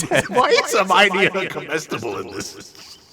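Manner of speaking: high, loud, laughing disbelief. Real, not acted. Reader baffled by Hermione being eaten, laughing. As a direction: ask it while cracking up.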